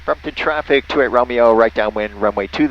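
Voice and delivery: usual speaking voice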